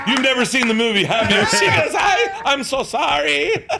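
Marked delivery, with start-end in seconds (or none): 0.1-1.4 loud, high, through laughter; imitated laughing accusation. Actor cracking up at the bluff. 2.0-3.7 high, loud, drawn-out mock apology; imitated apologetic co-star. Co-star caught bluffing, apologising.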